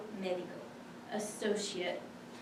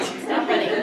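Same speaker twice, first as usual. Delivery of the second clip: mock yell; 13 dB louder, slower than usual. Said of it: "mothers yelling at their kids".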